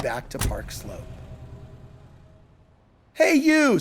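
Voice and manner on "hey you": high, raised hail; sudden higher-pitched call. A narrator's reading. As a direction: call to someone across the street. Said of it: "someone suddenly called".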